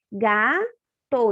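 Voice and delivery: "Gato" as Ga, pause, to; sounded out by syllable. Shown as a demonstration. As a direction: demonstrate the two sounds in "Gato" separately. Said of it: "tá dando som de gá". ("Gato" separado em dois sons, ga e to)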